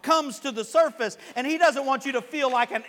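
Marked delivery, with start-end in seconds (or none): none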